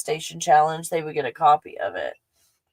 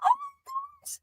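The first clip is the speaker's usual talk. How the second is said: very high, drawn-out squeal of joy; thrilled, high-pitched exclaiming; overexcited; 13 semitones higher, 5 dB louder, slower than usual. Real, not acted. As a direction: squeal in amazed delight, stretching the words. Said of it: "to be all excited about this".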